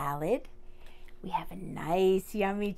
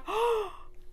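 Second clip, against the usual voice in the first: sharp gasp; 12 semitones higher than usual. Acted. Sneeze build-up.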